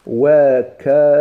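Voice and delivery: "وكاين" very slow and loud, wa-ka-yin; "وكاين" stretched out to show the variant; variant pronunciation. Shown as a demonstration. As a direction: break "وكاين" into long separate syllables. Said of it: "كاين لغة".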